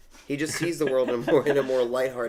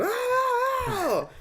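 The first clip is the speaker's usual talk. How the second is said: long, very high-pitched comic scream; mock cartoon scream of pain; imitated scream; 4 dB louder than usual. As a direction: let out a drawn-out falsetto shriek. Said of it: "you just hear him scream".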